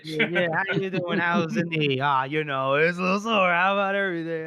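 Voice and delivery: imitating jovial catching-up between old pals; lightly acted friendly banter; chummy small talk.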